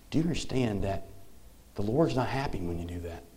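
father gently correcting his little daughter, softly, ending in a whisper; calm, not yelling. Retold or imitated. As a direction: hush it down like a gentle dad. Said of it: "in a calm voice, not yelling at her".

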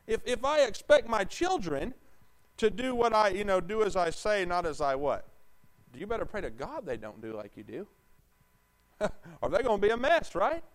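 high, loud, slowed-down pulpit fire; preaching at full force. Acted. Pastor pressing the congregation about their music, preaching hard and loud. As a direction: build it into a ringing challenge.

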